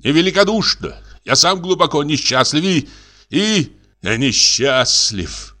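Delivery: high-pitched aggrieved protest; wounded cry. A narrator's reading. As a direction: plead your own misery, indignantly. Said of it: "крикнул Абогин". (высоко, с горькой обидой)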